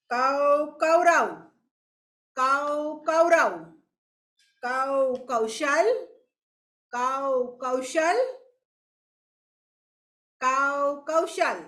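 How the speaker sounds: કૌ then Kaurava, each syllable drawn out very slowly; very slow drill. Shown as a demonstration. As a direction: stretch every syllable for the children. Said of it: "slow syllable drill". (કૌ કૌરવ ધીમે ધીમે ખેંચીને બોલો)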